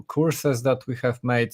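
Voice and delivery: normal talking voice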